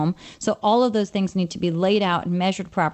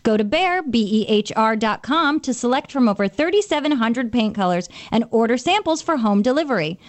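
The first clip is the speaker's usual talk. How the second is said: ad read; 3 semitones higher, 3 dB louder than usual. Acted. Host reads Behr ad.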